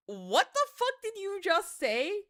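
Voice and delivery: incredulous friend. Retold or imitated. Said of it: "My man deadass stopped in his tracks".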